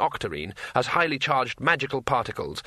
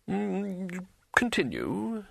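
higher, softer ruler's prompt; hum, then bid him continue in a high voice; arch astronomer; 8 semitones higher, 4 dB quieter, much slower than usual. A narrator's reading. Ruler tells craftsman to go on.

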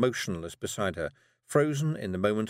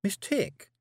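put-on girl voice; 12 semitones higher than usual. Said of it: "said Tiffany again".

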